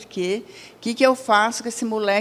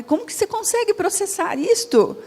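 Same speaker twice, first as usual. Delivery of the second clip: pitched much higher, marvelling at her fluency; amazed question; 6 semitones higher than usual. Retold or imitated. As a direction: ask in genuine astonishment, voice jumping up high. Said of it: "Eu falei".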